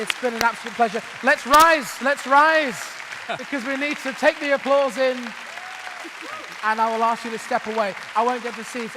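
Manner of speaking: loud, high rallying call to the room. Real, not acted. Host getting the audience up to applaud the panel, calling out. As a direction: raise your voice over the applause.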